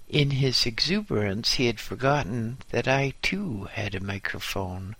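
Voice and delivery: ordinary delivery, nothing marked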